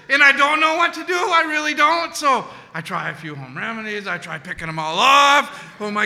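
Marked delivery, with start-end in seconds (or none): none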